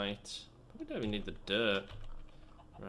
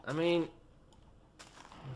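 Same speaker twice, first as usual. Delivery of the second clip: ordinary talk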